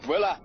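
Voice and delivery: short scornful laugh; bitter laugh. Acted. Bitter reply to "get another job.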